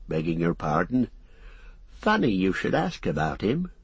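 country landlord with a lilting, swinging pitch; chatty tavern-keeper in full singsong flow; gossiping innkeeper. Acted. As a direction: share local gossip like a rustic innkeeper.

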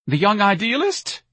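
high, slow, tearful question; sobbed question. A narrator's reading. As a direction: ask it as if in tears. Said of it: "Missus Levy sobbed".

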